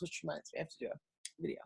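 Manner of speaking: reminding herself under her breath; quiet aside. Real, not acted. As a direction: say it under your breath, as a note to yourself.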